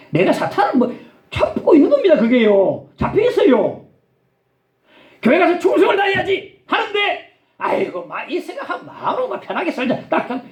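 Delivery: high-pitched, impassioned sermon delivery; ringing pulpit exhortation; forceful preaching. Real, not acted.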